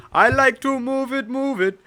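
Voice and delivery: put-on high, loud Indian-accented singsong. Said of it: "Move It in Indian accent".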